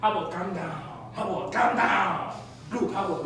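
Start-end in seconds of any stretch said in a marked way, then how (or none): none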